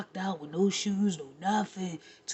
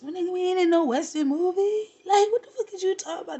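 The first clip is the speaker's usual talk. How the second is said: pitched way up, fast and incredulous; incredulous high retort; 9 semitones higher, 3 dB louder, faster than usual. Retold or imitated. Scoffing at him for saying this town ain't big enough, high and fast. Mocking his western line.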